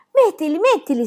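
high, loud, eager urging; excited child's shriek. A narrator's reading. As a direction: squeal it with impatience. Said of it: "strillò Pippi".

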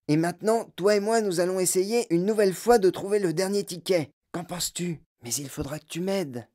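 put-on an old grandfather plotting with his grandson; old grandpa Joe. (voix de vieux grand-père complice)

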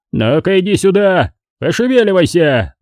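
high, cross order; angry shout. A narrator's reading. Mr. McCall orders his son home, angry shout. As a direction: order him home briskly. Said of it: "закричал сердитым голосом мистер Маккол".

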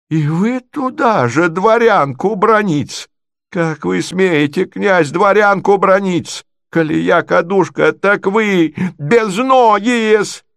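high, slow, swooping indignation; outraged provincial lady. Acted. Natalya scolds prince for insulting her, high and outraged. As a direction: stretch the scolding up to a jeer.

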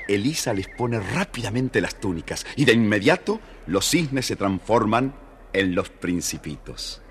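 ordinary delivery

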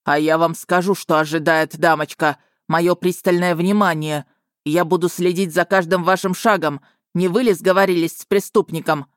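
cold warning from an officer; stern official. Acted. Dragon threatens her.

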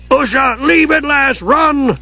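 high, urgent dwarf's shout; dwarf screaming. A narrator's reading. Dugan screaming at Usha to run. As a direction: scream for the lass to flee. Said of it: "Dugan screamed".

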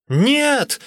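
high, loud cry of refusal; desperate high yell; wailing protest. A narrator's reading. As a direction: wail it out at the top of your voice. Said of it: "вопил я".

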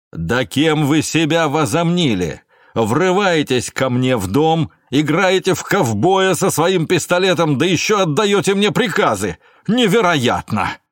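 loud, high, indignant tirade; angry outburst. Acted. Suspect rebukes gendarme who gives orders in his home, angry outburst. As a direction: rage with rising pitch, stretching the key words.

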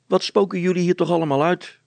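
high, loud, quick woman's voice; nosy wife. Acted. Wife comes in on the tinkerers, high and insistent. Wife bursts in questioning them.

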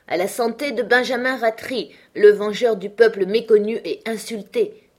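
loud, high rousing toast; a host crying out a toast to his guest; exclaimed toast. A narrator's reading. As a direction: raise the glass and cry it out to the table. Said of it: "s'écria monsieur Minxit".

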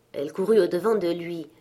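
ordinary delivery

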